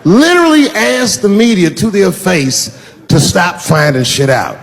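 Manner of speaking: ordinary talk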